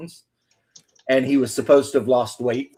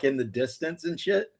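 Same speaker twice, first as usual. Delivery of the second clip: ordinary delivery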